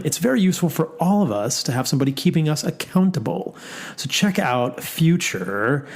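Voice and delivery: upbeat sponsor read, building to a hyped intro. Acted. Host reading a sponsor spot to listeners, upbeat ad read. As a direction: sell the trial, then hype the guest.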